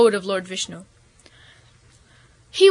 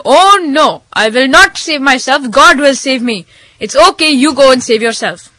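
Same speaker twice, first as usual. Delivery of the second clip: lightly voiced, emphatic holy man; stubborn holy man; 3 semitones higher, 7 dB louder than usual. Holy man refusing rescue from the flood, stubbornly assured.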